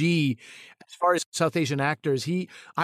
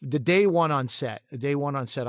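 usual speaking voice

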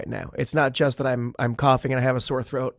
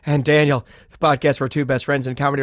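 sick hoarse voice; 4 dB louder, faster than usual. Real, not acted. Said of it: "this new sultry voice you've got".

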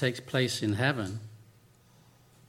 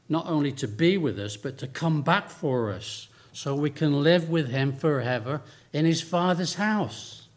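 higher, slow, swelling sermon voice; fervent preaching; 4 semitones higher than usual. Acted.

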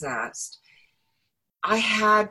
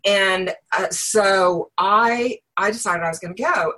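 ordinary talk

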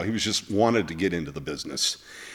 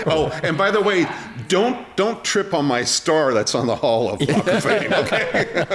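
high, loud, laughing taunt; the host laughs as he voices a cheeky comeback; laughing mock jab; 8 semitones higher, 4 dB louder than usual. Real, not acted. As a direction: tease through laughter. Host jokes about a mocking comeback.

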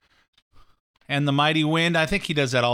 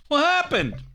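mock quote; 11 semitones higher than usual. Mocking Hillary's book title.

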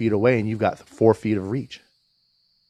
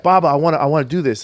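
put-on higher, louder child's plea; imitating pleading toddler; 6 semitones higher, 6 dB louder than usual. Toddler begging her dad to lift her up, higher and louder. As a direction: beg like a little kid who wants to try. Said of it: "And she's like".

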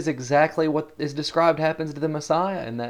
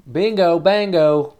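louder and higher, slow and showy; mock game-show cheer; 6 semitones higher, 8 dB louder, slower than usual. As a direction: play a cheesy quiz host calling out a win. Jesus as game-show host.

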